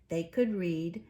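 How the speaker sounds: ordinary delivery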